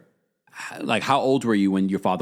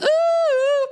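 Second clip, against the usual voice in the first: very high, loud ooh; high squealing "ooh"; surprised ooh; 5 dB louder than usual. Real, not acted. Reacting to a comment.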